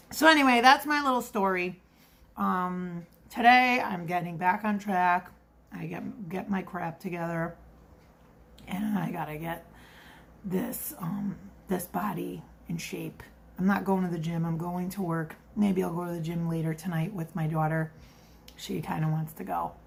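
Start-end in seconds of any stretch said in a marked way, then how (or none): none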